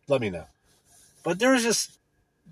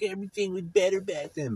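lightly voiced higher, softer complainer; nostalgic complainer; 6 semitones higher, 7 dB quieter than usual. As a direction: imitate that guy pining for old days, a bit higher. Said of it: "I hate to be that guy".

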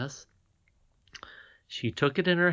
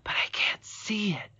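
high, wondering whisper; puzzled whisper; 10 semitones higher, 3 dB quieter than usual. A narrator's reading. Irene staring at her empty hand, in a puzzled whisper. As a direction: whisper it, baffled. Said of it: "she added".

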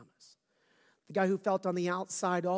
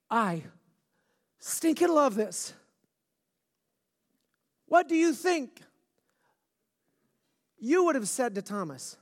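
rising, louder preacher fervor; preaching; 7 semitones higher, 7 dB louder than usual. Acted. Pastor stirring up his Easter congregation, fervent preaching.

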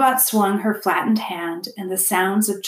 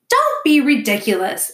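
higher, louder scolding interruption; raised-voice wifely rebuke; interrupting; 6 semitones higher, 6 dB louder than usual. A narrator's reading. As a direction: cut in as an exasperated beaver wife. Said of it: "interrupted Missus Beaver".

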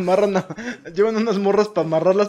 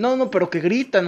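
ordinary talk, nothing marked

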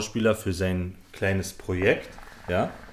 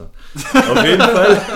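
much higher, louder and slow, laughing through it; 10 semitones higher, 10 dB louder, much slower than usual. Real, not acted. Retelling the odd casting story, laughing. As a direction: dissolve into laughter on the words.

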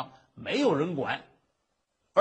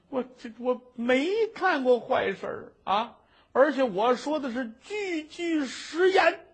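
tearful, higher plea of innocence; crying plea; 7 semitones higher than usual. Acted. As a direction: cry while swearing it is true. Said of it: "你还敢掉眼泪儿".

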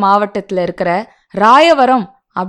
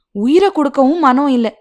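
ordinary delivery

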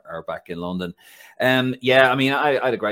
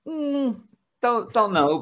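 hesitant doubt; 5 dB louder, slower than usual. Retold or imitated. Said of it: "I thought".

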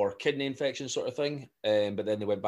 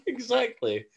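high, slow laughing word; 13 semitones higher, slower than usual. Real, not acted. Both laughing.

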